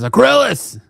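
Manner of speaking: high, loud call across the field; shouting a name. Retold or imitated. Diana calling the villain out to fight, shouting. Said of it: "shouts out his name".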